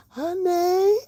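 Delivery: a high, sassy "honey". Real, not acted. Teasing her viewers about the avatar, sassy.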